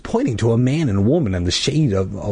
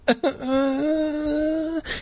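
forced laugh; 5 dB quieter than usual. Acted. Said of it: "forcing a smile".

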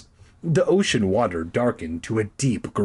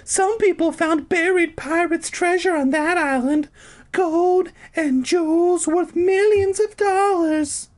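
high woman voice; 3 dB louder than usual. Acted. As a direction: play Carolyn high and sweet. Carolyn's high voice.

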